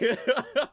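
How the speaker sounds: squealing laugh at the clip; high burst of laughter. Real, not acted. Streamer losing it over a viral clip, loud high laugh. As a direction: crack up helplessly, high and loud.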